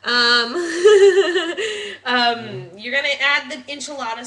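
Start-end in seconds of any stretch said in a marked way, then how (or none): 0.6-2.0 loud bursting laugh; real laughter. Real, not acted. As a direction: crack up at your own try. Laughs at herself.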